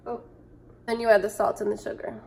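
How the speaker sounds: normal talking voice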